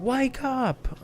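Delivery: high mock-yelled Wake up! like a tiny bat mascot; a high, cartoonish mock yell of Wake up!; imitating Kivat yelling. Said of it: "Kivat yelling".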